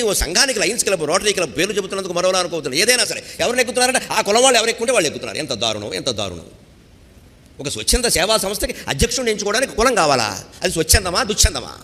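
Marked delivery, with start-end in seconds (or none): 5.4-6.5 quiet lament. Acted.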